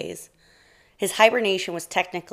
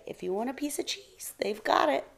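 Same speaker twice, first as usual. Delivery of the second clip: hushed, tempting whisper; 7 semitones higher, 5 dB quieter than usual. A narrator's reading. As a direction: whisper it like a secret. Mouse tempting bear secretly.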